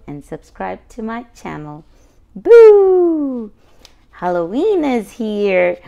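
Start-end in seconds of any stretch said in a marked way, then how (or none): 2.4-3.5 playful boo. Acted. Reading a spooky picture book.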